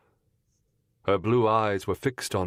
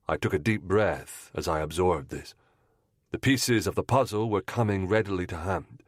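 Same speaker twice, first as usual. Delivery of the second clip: usual speaking voice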